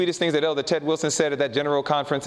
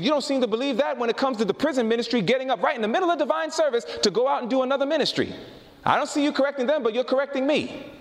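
higher-pitched, indignant rebuttal; retold protest, pitched up and pressing; indignant challenge; 8 semitones higher than usual. Preacher retelling his challenge to the elders, indignant.

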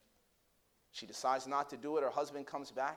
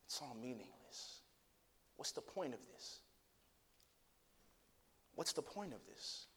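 hushed lament; 9 dB quieter than usual. Retold or imitated. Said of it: "the cry of Ecclesiastes".

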